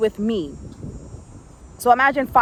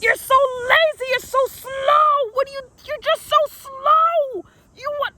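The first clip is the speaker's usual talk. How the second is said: imitating screaming coach. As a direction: scold like a coach at the end of her rope. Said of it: "she's going crazy and she's crying".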